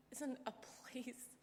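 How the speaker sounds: slow whispered "isn't a place"; tender whisper. Retold or imitated. Father comforting daughter on his lap, slow whisper. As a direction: breathe it gently, like a secret truth. Dad gently correcting grown daughter.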